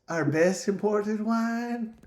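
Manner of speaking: put-on high, slow old-Hollywood accent droid; forced forties Hollywood accent. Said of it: "that's how this droid talks".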